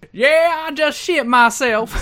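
loud, high Southern twang; hammed-up Southern drawl; Dixie Carter. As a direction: holler it in a mock Southern accent. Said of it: "I got a little Dixie then".

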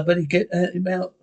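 ordinary delivery

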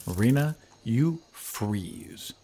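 slow, hushed scene setting; GM scene narration. Acted. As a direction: draw the players into the freeze. GM describes paralysis.

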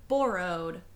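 borrowed said with a Canadian accent, vowel rounded like bore-rowed; Canadian accent slipping out on borrowed. Real, not acted. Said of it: "I love when your Canadian accent comes out".

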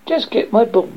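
high, mildly snappish order; snappish princess. A narrator's reading. Princess orders frog after the ball, high and snappish. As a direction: demand it like a spoiled princess. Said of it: "the princess snapped".

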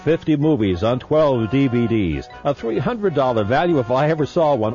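punchy pitchman ad read; infomercial. Acted. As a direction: sell it like a late-night TV deal. Recorded movie-collection ad read.

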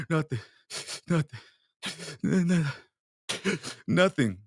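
broken sobbing stammer; mock crying. Crying kid brushing off friends' questions, mock sobbing. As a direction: gasp between words like a crying kid. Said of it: "crying your fucking eyes out".

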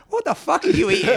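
high, laughing mock disgust at foreign food; mock outraged guest. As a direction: squeal it out in laughing disbelief. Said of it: "everyone was clearly looking at each other's breakfast going".

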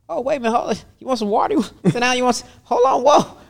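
startled therapist fussing over a client; alarmed. Retold or imitated. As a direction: scramble to calm him down, alarmed. Alarmed reaction to "kill her.

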